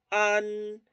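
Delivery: "An" ending in a held N; tongue up, sound "An" through nose and mouth; front nasal N. Shown as a demonstration. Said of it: "es nasal y también de la boca".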